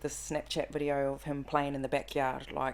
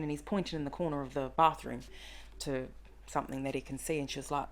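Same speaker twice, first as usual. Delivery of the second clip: ordinary talk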